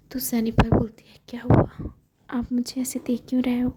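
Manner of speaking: hushed whisper for an intimate scene; whispered storytelling. Acted. Narrator telling the couple's tender moment, whispered. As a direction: whisper every word as if someone might overhear.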